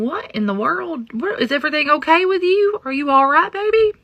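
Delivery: high, loud worried calls to her son; rising panic, checking on her child; alarmed mother. Retold or imitated. Mother finds her son terrified at two in the morning, high worried voice. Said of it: "I'm going".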